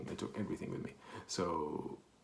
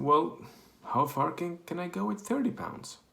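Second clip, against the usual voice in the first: hopeful plea; 9 semitones higher, 4 dB louder than usual. Retold or imitated. Asking the clerk with little money.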